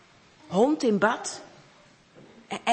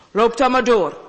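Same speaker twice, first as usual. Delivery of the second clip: grudging man; 6 dB louder than usual. Acted. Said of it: "zegt die man".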